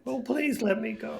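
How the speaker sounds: high nervous plea; pleading captive. Acted. Captured brigand begging the snake's owners, high and pleading. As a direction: beg to be let go.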